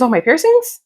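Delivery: high-pitched, lightly acted query; questioning reply. Heroine answering his question about rocks on her face, mock-surprised query.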